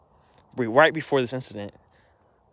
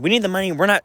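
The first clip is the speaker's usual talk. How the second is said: higher, louder mock of Mom yelling; 7 semitones higher, 4 dB louder, faster than usual. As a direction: throw Mom's line back in a shrill, raised mock. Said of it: "Mom starts eventually screaming at me".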